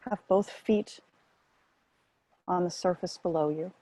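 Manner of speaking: calm, measured meditation guidance with long pauses; guided meditation. Real, not acted. Meditation teacher settling listeners onto the breath as anchor, guided meditation. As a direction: ease them into stillness, one short phrase at a time.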